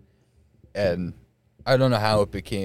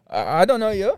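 high, stammered mock nervousness; nervous delivery man; 10 semitones higher, 5 dB louder than usual. Delivery man answering a greeting, nervous. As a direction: play a flustered delivery guy, pitched up. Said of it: "He's probably nervous".

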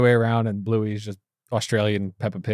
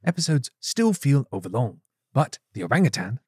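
measured British nature narrator; David Attenborough; 4 dB quieter than usual. Acted. Co-host proving his impression, Attenborough voice. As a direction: narrate it like a wildlife documentary. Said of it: "I was going for David Attenborough".

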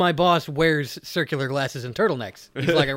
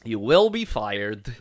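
mock stern boss; 7 semitones lower, slower than usual.

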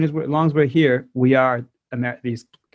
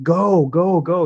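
higher, repeated rallying call; mock urgent urging; 4 semitones higher, much slower than usual. Retelling the call to seize their chance, mock urgent. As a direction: imitate sirens urging you on. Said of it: "It was like sirens all around".

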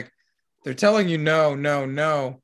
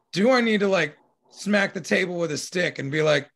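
ordinary talk

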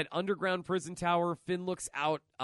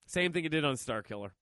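normal talking voice

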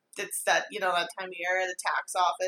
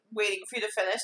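ordinary talk, nothing marked

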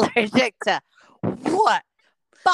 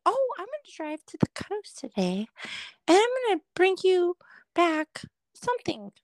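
higher-pitched coworker imitation; mimicked coworker; 7 semitones higher than usual. Coworker announcing a trip to the coast, high mimicking voice. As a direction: voice an oblivious workmate up high. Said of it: "There's people at work that tell me".

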